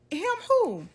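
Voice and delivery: high, loud sharp question; grandma questioning. Retold or imitated. Grandma asking the child who she means, high and loud. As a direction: demand an answer as grandma. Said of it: "She's like".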